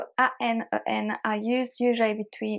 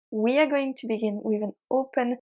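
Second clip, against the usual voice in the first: ordinary delivery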